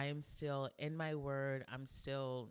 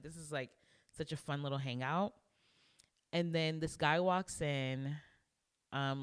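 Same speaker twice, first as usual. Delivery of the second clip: ordinary talk, nothing marked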